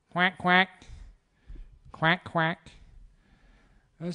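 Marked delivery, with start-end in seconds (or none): 0.1-2.7 short, louder quacks; duck imitation. Storyteller imitates the bird sound the couple heard, duck imitation. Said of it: "give me a chance to do some, some bird imitations".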